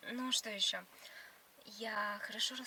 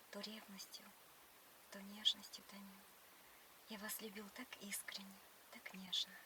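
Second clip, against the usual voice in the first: whispered poem recitation; 4 semitones lower, 13 dB quieter than usual. Pretend. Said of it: "сначала ты стих расскажи мне".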